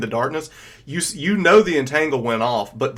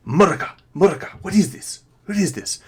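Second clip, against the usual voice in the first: quick, higher-pitched questioning; lightly put-on voice, hurried and higher; lightly voiced Bellatrix; 4 semitones higher, faster than usual. Game master as Bellatrix looking back at the others, lightly voiced, hurried. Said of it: "um, she goes".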